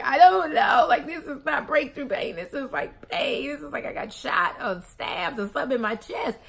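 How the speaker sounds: high, loud, frantic retelling of the pain; panicked pleading. Retold or imitated. Retold panic to the nurse.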